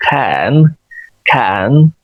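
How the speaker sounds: "can" with the vowel of "cat", drawn out; aspirated k, open vowel. Shown as a demonstration. Teacher modelling the first syllable, aspirated "can". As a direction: puff air on the k of "can". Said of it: "इसको वैसे ही बोलेंगे".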